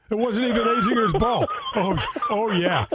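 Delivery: laughing through the words. Real, not acted. Host cracking up at the Azinger story, laughing. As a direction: laugh it out at the punchline.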